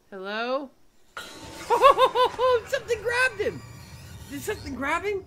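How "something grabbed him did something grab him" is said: very high, loud scared laughter into words; real fright spilling over; shrieking laugh. Real, not acted. Player watching a man get grabbed, shrieking.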